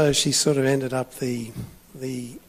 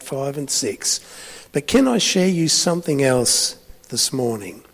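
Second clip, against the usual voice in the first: ordinary talk, nothing marked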